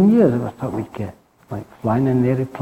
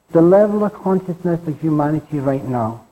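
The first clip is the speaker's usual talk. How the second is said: ordinary talk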